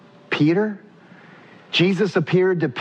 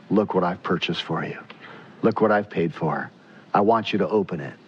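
softer and lower, gentle and inviting; Jesus gently offering grace to sinners, softer; tender offering; 9 semitones lower, 4 dB quieter than usual. Retold or imitated. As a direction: offer the gift softly, eager to give. Jesus offering his gift.

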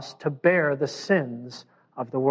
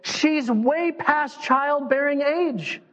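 higher-pitched dawning amazement; astonished Mary; 8 semitones higher than usual. Acted. Mary realizing her cousin Elizabeth is pregnant, astonished. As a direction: build from quick realization to high, stretched-out disbelief. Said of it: "to help grow her in her faith to go".

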